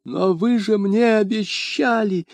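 high, slow, barely audible babble; a frightened shopkeeper pleading weakly; высокий испуганный лепет; faint babble. A narrator's reading. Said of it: "чуть слышно пролепетал".

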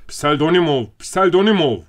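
loud, high call for help; narrated urgent shout; crying out. A narrator's reading. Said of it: "diye haykırdı".

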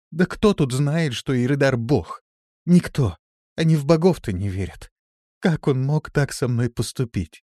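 loud, high, distressed protest; disbelief and pain. A narrator's reading. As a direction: pour out hurt, bitter questions. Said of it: "читались недоверие и боль". (с болью и недоверием)

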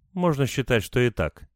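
ordinary delivery

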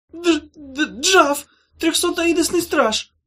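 high, slow, stuttering whimper; terrified creature stuttering; stammering in terror. Acted. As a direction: choke out the name through sobs.

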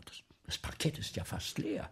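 hushed and fearful; a worried director whispering; anxious whisper. Acted. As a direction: whisper your dread. Director worrying about empty house.

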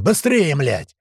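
high, loud start, dropping on the curse; impatient swearing shout. Acted. Tuman rushes the platform off, angry shout. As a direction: hurry them with an angry yell.

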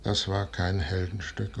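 ordinary talk

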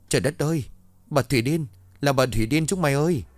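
high, panicked yell while fleeing; panicked shout. A narrator's reading. Boy fleeing the mad woman, yelling to friends in panic. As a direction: cry out in terror as you run. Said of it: "vừa chạy nó vừa hét lên".

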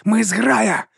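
high wolf cry of belonging; wolf reminding. A narrator's reading. Wolf reminding the stirring dragon, high and proud. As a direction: remind them they are pack. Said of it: "нагадував".

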